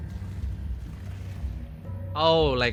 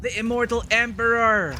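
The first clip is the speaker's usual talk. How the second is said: excited exclaim; 7 semitones higher, 3 dB louder than usual. Real, not acted. Emperor worm appears on screen.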